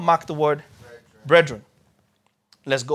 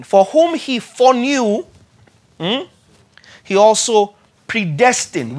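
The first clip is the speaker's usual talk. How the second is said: slow, loud, weighty reading of "For whom he foreknew"; measured pulpit reading; solemn scripture reading; 5 semitones higher, 6 dB louder, slower than usual. Acted. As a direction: read it as a proclamation.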